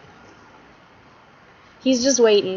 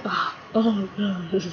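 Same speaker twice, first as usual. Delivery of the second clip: mock moaning noises; 2 semitones lower, 6 dB quieter, slower than usual. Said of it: "making those faces and those noises".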